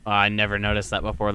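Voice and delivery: ordinary delivery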